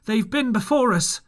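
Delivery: pirate calling down from the window. A narrator's reading. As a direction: shout the bad news below. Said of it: "he cried".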